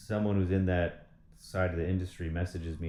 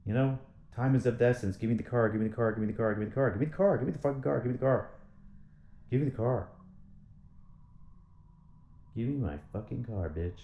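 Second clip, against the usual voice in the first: impatient demand; 4 semitones higher, faster than usual. Real, not acted. Demanding the car repeatedly.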